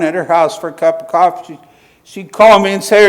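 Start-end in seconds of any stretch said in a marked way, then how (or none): none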